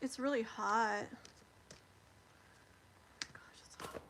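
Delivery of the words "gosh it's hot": whisper. Real, not acted. Complaining of heat.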